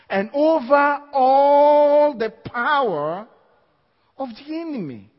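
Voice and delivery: slow, loud, rising preacher's cry; preaching. Acted. Preacher booming scripture to the congregation. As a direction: stretch it out to the congregation.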